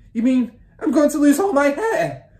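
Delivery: high, loud panicked yell; husband's yell. A narrator's reading. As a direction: yell it, aghast. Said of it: "the husband yelled".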